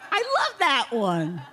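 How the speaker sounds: normal talking voice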